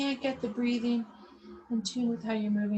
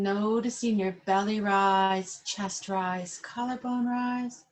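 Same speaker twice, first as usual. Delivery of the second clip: calm, unhurried yoga instructor cueing the breath; guided breathing. Acted. Yoga teacher guiding seated class through belly breathing, calm guided voice.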